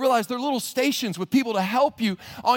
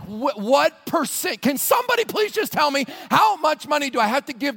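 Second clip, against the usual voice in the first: exasperated; 5 semitones higher than usual. Acted. Pilgrim demanding a number from the experts, exasperated. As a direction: plead at the top of your voice.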